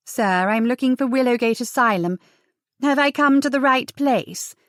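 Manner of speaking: genteel voice rising into the question; genteel miss. Acted. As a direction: play the proper young lady. Ties poses as well-bred miss.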